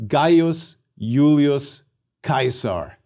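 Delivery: Gaius Julius Caesar slowly as GUY-us YOO-lee-us KY-sar; classical Latin pronunciation. Shown as a demonstration. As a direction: model "Gaius Julius Caesar" word by word, hard K in Caesar. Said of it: "Please repeat".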